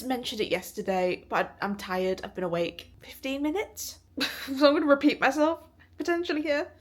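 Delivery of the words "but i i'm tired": ordinary talk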